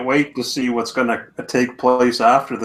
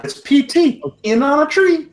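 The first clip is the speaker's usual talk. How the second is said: much higher, joking character voice; high squeaky voice; 14 semitones higher than usual. Pretend. Joking in the Papa Texas voice.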